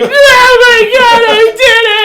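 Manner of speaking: high, drawn-out, loud joyful wail; hammed-up happy sobbing on the words; bawling. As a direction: reenact bawling in overwhelmed triumph. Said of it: "I start bawling".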